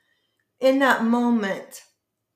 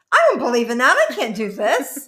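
louder, higher alarmed outburst breaking into a laugh; mock panic at herself, laughing; startled retold protest; 3 semitones higher, 7 dB louder than usual. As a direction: blurt it in half-laughing disbelief. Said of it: "I said to myself".

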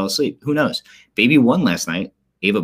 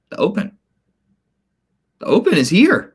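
excited announcement; 12 semitones higher, 4 dB louder than usual. Real, not acted.

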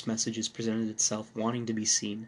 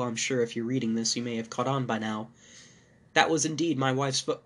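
ordinary delivery

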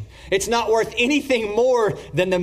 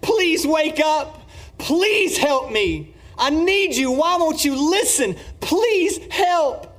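desperate pleading worshiper; 9 semitones higher than usual. Retold or imitated. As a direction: plead with a wooden idol that will not wake. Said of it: "kneeling down to this carved image ... and saying".

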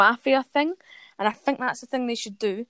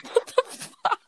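breaking into giggles mid-sentence; cracking up mid-line; 9 semitones higher, 3 dB louder, slower than usual. Real, not acted. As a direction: laugh through the words. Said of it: "I'm corpsing".

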